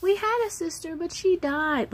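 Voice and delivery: put-on high, small little-girl voice; child's voice. Daughter telling a stranger about her sister, high childlike. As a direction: speak it plainly like a small child. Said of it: "I could just see my daughter".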